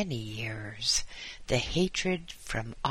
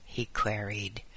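plain reading voice

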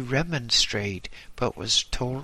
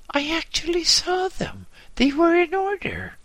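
protesting officer; 4 dB louder than usual. A narrator's reading. Junior officer protests to superior.

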